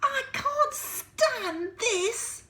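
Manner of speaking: very slow, high and loud complaint; exasperated Mister Bear. Acted. Mister Bear kept awake, slow high exasperated wail. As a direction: drag every word out in despair. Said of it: "said Mister Bear".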